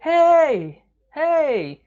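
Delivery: high, drawn-out calling "hey"; imitated shout. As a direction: call out like Thor trying to get attention. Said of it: "Ele fica gritando". (grito imitado, agudo e alongado)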